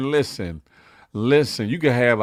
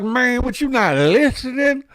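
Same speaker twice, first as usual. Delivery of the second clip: Mob James on the stand; 9 semitones higher than usual. Retold or imitated. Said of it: "He's like".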